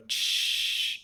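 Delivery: long hushing wind whoosh; rushing wind noise. Retold or imitated. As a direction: imitate wind roaring into a microphone. Imitates wind in hearing aid.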